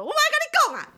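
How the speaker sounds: very high dismissive snap; mock retort. Seller ending the quarrel with the caller, high snap. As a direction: cut the caller off sharply. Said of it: "我就说".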